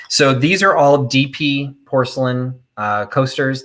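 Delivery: ordinary talk, nothing marked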